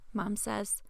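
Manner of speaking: ordinary delivery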